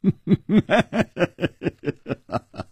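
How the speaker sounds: long scornful villain's laugh; big laugh. A narrator's reading. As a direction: roll out a long, high staged laugh. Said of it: "大笑". (长声大笑)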